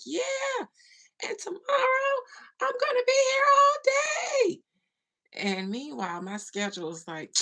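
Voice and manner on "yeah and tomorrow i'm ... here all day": high, loud, hammed-up squeal; mock heat-of-the-moment excitement. Imitating herself promising yesterday's viewers, overexcited high shout. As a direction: squeal it out, mocking your own overexcitement. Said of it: "in the heat of the moment".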